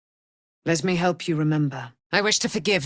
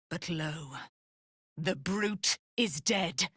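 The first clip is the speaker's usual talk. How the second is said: softer and slow, hushed; hushed awe; 9 dB quieter, slower than usual. Acted. Dame Aylin after the brute dies, hushed wonder. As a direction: breathe it out in wonder.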